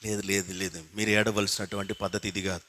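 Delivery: softly voicing Jesus, tender and quiet; a hushed, loving no to the weeping women; Jesus gently telling the weeping daughters of Jerusalem not to weep for him, hushed; gentle soft rebuke. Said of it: "ఒక మాట చెప్తా ఉన్నాడు".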